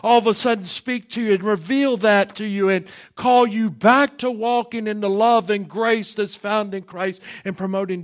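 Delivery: loud, high, ringing pulpit preaching; full-force preaching. Acted. Pastor pressing the congregation about divisive ways, preaching at full force. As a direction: preach it out over the whole congregation.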